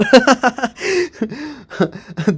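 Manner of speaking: high burst of real laughter; bright laugh out loud. Real, not acted. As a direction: break into a big laugh. Laughs after joking threat.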